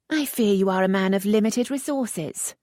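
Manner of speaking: a touch of mockery; lightly voiced teasing lady. A narrator's reading. Lisette teasing the earl, lightly arch.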